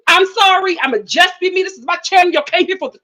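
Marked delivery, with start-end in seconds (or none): none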